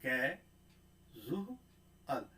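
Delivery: कैजुअल drawn out, every sound separate; slow demo. Shown as a demonstration. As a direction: stretch out कैजुअल, with the zh sound held. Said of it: "ध्यान दीजिए, अगर हम ऐसे".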